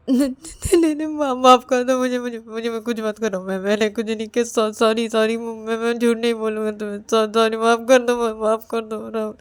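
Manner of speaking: panicked, high, stammering pleas; crying in terror. Acted. Ravi shrinks from Rahul's touch, crying and begging. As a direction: beg frantically, words tripping over sobs. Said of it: "रो क्यों रहे हो?".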